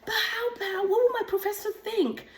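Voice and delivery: fretting over what everyone thinks; lightly voiced worried client; anxious clients. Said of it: "they come to say".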